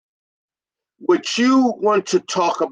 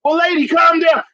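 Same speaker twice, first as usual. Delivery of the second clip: loud, higher, curt reply to an angry caller; irritated technician; 6 semitones higher, 3 dB louder than usual. Acted. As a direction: tell her to calm down, loudly. Technician snaps back at caller.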